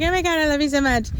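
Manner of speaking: quick, high voice for a smitten dog; adoring dog. Retold or imitated. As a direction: gush like a delighted dog. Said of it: "talking crap about the dog in this sweet tone. The dog's like".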